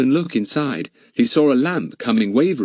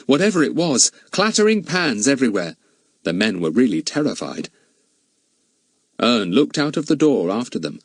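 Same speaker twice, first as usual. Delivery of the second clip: ordinary delivery, nothing marked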